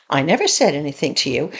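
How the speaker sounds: mild, low-pitched show of innocence; timid and passive. Retold or imitated. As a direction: imitate someone backing down innocently. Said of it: "goes into this timid little passive mode".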